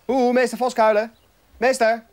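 high, loud schoolkid-like call; calling the headmaster. Acted. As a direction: call out insistently for the teacher's attention.

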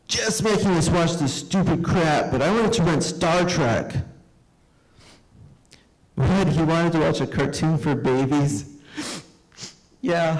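high, mocking boys; a hint of brothers ganging up on the guest; tattling kids. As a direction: sneer like older brothers.

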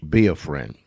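ordinary delivery

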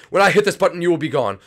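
hammed-up villain triumph; triumphant villain. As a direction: slam the button with glee. Villain triggers the machine.

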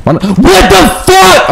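high, drawn-out yell of disbelief; exasperated yell. Real, not acted. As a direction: burst out high and frustrated. Outburst at game events.

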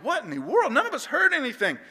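high-pitched mock bafflement; imitated kids, utterly mystified; bewildered friends. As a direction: protest innocence in a high, puzzled voice. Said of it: "We're all thinking".

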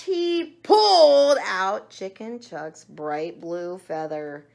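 loud, slow, stretched-out telling; dramatic narration. A narrator's reading. Reader tells of the feather pulled out, loud dramatic narration. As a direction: draw out the pull with force.

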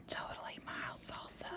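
hushed fine-print whisper. A narrator's reading. Parent reading the fine print to child, whispered.

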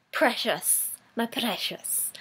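softly hissed, hoarded-treasure whisper; hushed creature voice. Acted. Fan coos over unopened figures, hushed creature voice. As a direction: murmur it like a covetous creature.

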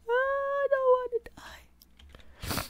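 very high, slow, whispered sob of "I don't wanna die"; hammed-up dying sob; mock sobbing whisper. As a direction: fade into a weeping whisper. Character about to die.

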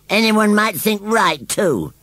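high, slow indignant roar; roaring scarecrow. A narrator's reading. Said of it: "roared Gummidge".

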